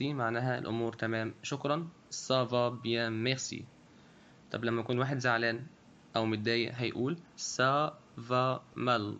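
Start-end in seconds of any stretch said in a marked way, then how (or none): 2.1-3.6 slow model phrase. Shown as a demonstration. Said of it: "Répétez".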